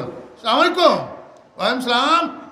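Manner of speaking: high, loud, clipped greeting and reply; hammed-up political salam exchange; mock politician's salam. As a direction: parody a politician trading greetings. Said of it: "উনাদের সালামার জবাব".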